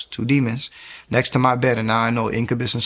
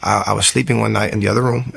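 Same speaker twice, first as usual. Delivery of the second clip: normal talking voice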